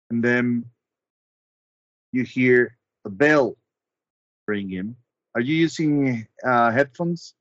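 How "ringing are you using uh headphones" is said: ordinary delivery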